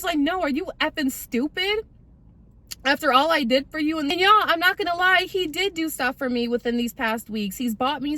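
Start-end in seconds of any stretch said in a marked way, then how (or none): 0.2-4.0 higher-pitched mock-indignant retort; hammed-up wounded anger; imitated going off. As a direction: act out his outraged disbelief in a raised pitch. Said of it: "He was going off".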